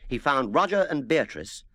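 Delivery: plain reading voice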